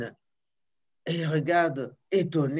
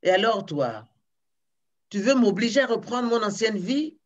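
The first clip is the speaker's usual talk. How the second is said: a giant's irritated growl, pitched up and louder; a cross giant rounding on the fisherman, angry; 5 semitones higher, 4 dB louder than usual. A narrator's reading. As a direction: scold him like an offended giant. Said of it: "d'un air très courrouceux, courroucé, il lui dit".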